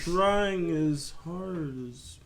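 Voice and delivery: very slow, drawn-out mock plea; slow teacher imitation. Student mocking a tired instructor, exaggeratedly slow. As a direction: drag every word, imitating the instructor. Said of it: "Not as slow".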